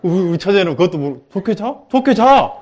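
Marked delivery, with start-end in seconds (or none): 1.3-2.5 rising to a loud, much higher insistent 좋게 자; insistent repeat. Retold or imitated. 처제가 못 알아듣는 자매에게 답답하게 외침. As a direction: repeat it, getting louder and more insistent.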